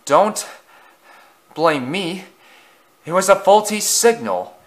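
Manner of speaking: huffing, high and winded; out of breath. Acted. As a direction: puff the words out, breathless. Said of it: "Edward huffed out of breath".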